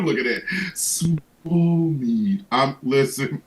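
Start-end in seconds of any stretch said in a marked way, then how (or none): 0.8-2.4 very slow, stretched Swole Meat; a slow, relishing reveal; drawn-out punchline. Acted. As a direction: savor each word.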